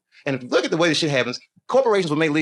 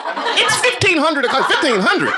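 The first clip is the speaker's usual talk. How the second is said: mock posh clock-teller; 13 semitones higher, 5 dB louder, slower than usual. Mocking 24-hour clock.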